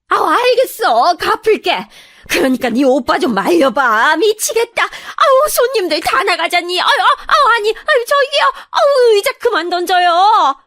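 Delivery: high, loud flustered shrieking; panicked wailing, way up in pitch; frantic panic. Acted. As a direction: beg them to stop as things fly around you. Cornered in-law as shop is wrecked.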